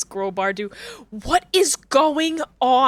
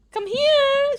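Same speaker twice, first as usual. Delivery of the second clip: very high drawn-out pet call; high sing-out to coax a cat; pleading high pet voice; 13 semitones higher, 3 dB louder than usual. Real, not acted. Calling cat from under bed.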